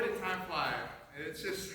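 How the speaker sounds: ordinary delivery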